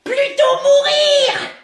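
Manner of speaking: high, slow defiant cry; melodramatic refusal. Acted. Child refusing to leave for school, melodramatic. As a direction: declare it dramatically, dragging it out.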